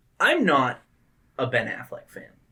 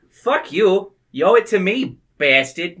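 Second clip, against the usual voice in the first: loud mock Boston accent; badly faked Boston accent, higher and louder; terrible accent; 7 semitones higher, 8 dB louder than usual. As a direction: yell it like a bad Boston tough guy. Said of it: "That's a terrible accent".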